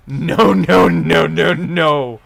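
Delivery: loud exasperated no no no; loud repeated no. Real, not acted. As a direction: refuse it loudly, over and over. Streamer refusing to look at tweets.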